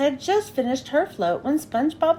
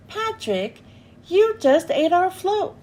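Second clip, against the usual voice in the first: louder, higher indignant protest; 5 semitones higher, 3 dB louder than usual. A narrator's reading. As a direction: protest to a friend in disbelief. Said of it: "protested SpongeBob".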